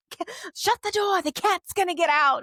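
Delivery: high-pitched hammed-up old witch; squeaky witchy voice impression. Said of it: "she's like".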